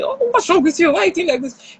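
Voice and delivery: put-on high, exasperated scold of a child; upset scolding. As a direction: snap at the child, fed up. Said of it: "get upset and say".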